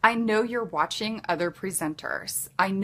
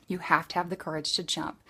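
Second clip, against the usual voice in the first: ordinary talk, nothing marked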